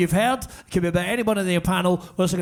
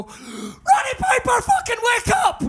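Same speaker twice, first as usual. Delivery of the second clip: yelling wake up; much slower than usual. Retold or imitated. Tour manager finally yells at unconscious wrestler, desperate shout. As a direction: scream him awake at last. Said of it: "and finally I go".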